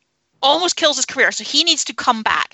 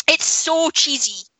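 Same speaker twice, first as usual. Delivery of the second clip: usual speaking voice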